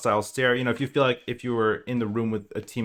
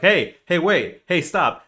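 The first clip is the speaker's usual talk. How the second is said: louder, higher calls to stop a teammate; calling out; 7 semitones higher, 6 dB louder, slower than usual. Retold or imitated. Teammate ignoring you, so calling out loud and high. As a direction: shout to get a teammate's attention. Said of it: "you're like".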